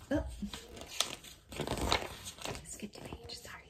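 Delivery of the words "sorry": ordinary delivery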